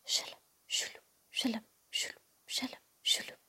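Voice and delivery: soft, whispered slish-slosh of mopping; imitated sloshing. A narrator's reading. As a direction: whisper a repeated watery swishing noise. Mop and water noises imitated.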